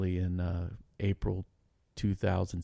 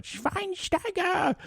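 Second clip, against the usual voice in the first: drawn-out, much higher mock German shout of the name; mock German yell; much slower than usual. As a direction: stretch the name out in a hammed-up German accent. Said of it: "running around our house screaming".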